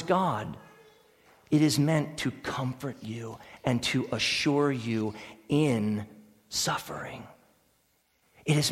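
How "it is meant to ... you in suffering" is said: tender comfort. Real, not acted.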